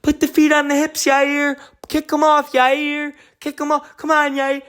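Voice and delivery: high-pitched mock scream from ringside; piercing imitation of shouted cornering; imitated screaming. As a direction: yell it high, imitating a woman screaming advice to a fighter. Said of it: "and she's screaming".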